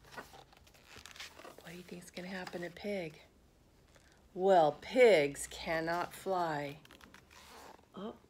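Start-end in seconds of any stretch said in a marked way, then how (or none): 0.1-3.2 suspense whisper. Real, not acted. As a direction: whisper the question to build suspense. Suspense question to children.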